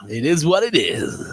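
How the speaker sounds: imitating a Dave, lazy southern drawl; southern accent. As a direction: say it like a laid-back Southerner. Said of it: "I channel my inner Dave then".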